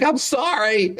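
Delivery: put-on small child. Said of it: "It's like".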